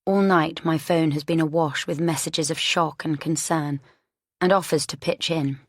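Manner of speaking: plain reading voice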